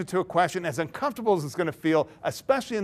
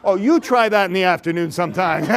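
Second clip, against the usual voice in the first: ordinary talk, nothing marked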